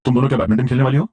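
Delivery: quick, casual question; offhand, quick question. A narrator's reading. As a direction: toss it off lightly and fast. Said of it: "casually पूछा".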